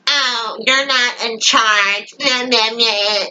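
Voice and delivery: slow, whiny mock voice; mocking Miller. Fan mimicking Miller butting in, whiny mockery. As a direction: drag it out as a sneering nag. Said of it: "has to step in and be like".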